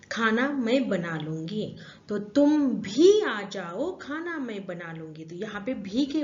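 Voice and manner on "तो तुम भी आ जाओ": slow, leaning hard on भी; slow, firm emphasis on भी; stress on भी. Shown as a demonstration. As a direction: press the word भी slowly. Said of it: "हम जोर दे रहे हैं".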